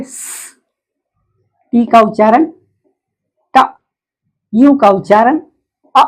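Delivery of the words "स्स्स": "स" as a sustained voiceless sss; bare S sound. Shown as a demonstration. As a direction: hiss "स" without any vowel. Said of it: "कैसे कहेंगे". ("स" बिना स्वर, लंबा स्स्स)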